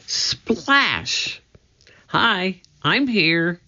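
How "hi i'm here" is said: usual speaking voice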